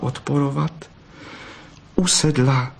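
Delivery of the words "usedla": ordinary delivery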